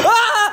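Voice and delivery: high, sudden cry on slipping; sharp yelp of surprise. Real, not acted. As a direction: yelp as your foot goes. Slips on wet floor.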